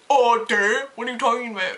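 loud, dopey dude voice; mock dude. Cashier mimicking the clueless customer, mock dude voice. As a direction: play the clueless bro.

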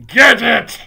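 high, very loud witch's order; explosive villainess yell; shouted command. Acted. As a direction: shout it fiercely at the coven. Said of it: "shouted the Grand High Witch".

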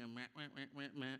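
hammed-up self-pitying wah wah; mock whining wah wah. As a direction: whine like a sulking child, mocking yourself. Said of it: "operating like a victim".

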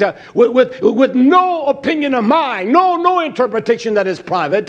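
loud, high, hammering sermon; fiery preaching. Acted. Preacher rousing his flock, loud and high. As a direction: preach it hard to the congregation.

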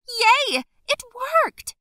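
loud high squeal of joy; excited yell. Acted. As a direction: cheer it out, thrilled. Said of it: "I yelled".

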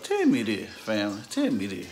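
ordinary talk, nothing marked

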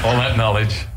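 mock Stephen Fry. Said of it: "that Stephen Fry thing".

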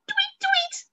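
very high chirping tweet; birdsong tweet. A narrator's reading. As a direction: chirp it like a tiny bird. Birds singing in story.

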